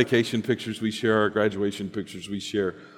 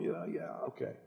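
soft, mumbled giving in; reluctant mumble; 6 semitones higher, 14 dB quieter than usual. Acted. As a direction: mumble the concession. Husband reluctantly gives in.

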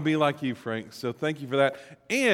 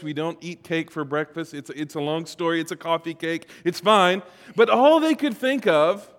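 ordinary talk, nothing marked

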